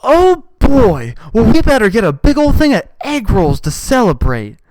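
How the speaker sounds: high, loud gushing put-on voice; mock woman squealing. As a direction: squeal it out like a giddy girlfriend. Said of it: "and she goes".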